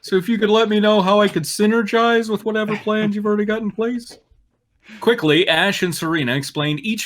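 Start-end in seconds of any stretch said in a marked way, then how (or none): 0.0-4.2 higher, louder, quick put-on Drew voice; ad-libbing as Drew. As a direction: riff as Drew, pitched way up and eager.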